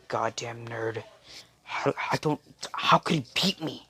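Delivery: hushed, half-whispered mock grumble; inner grumbling. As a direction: act out stewing in your head, very soft. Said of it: "in his head, scrunching, like".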